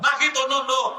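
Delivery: mocking scold. Boss echoes the same scold.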